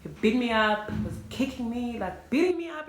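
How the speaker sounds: loud, rising upset; loud upset retelling; upset emphasis. Real, not acted. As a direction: push it out, outraged. Distressed retelling of beating.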